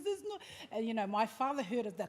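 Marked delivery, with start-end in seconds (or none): 0.0-0.4 high, upset mimicry of a sister; mock tearful protest, pitched way up; imitated crying. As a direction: imitate a sister starting to cry. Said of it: "she started crying".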